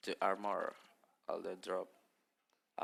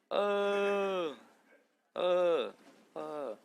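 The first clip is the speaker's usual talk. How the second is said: scared gasp; 8 semitones higher, 9 dB louder, much slower than usual. Real, not acted. Startled by jump scare in game.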